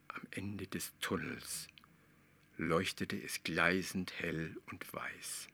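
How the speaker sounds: slow unvoiced whisper; hushed whisper. A narrator's reading. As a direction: breathe it out in awe. Geheimnisvolle Entdeckung im Tunnel. (ehrfürchtig geflüstert)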